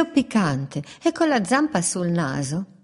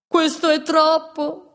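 fed up; 9 semitones higher, slower than usual. A narrator's reading. Wolf fed up after dung.

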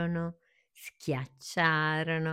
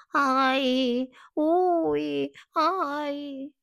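high, drawn-out mock yelps; repeated cries of pain; 11 semitones higher, 5 dB louder, much slower than usual. A narrator's reading. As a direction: yelp as if bumped and sore. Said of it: "non smetteva più di dire".

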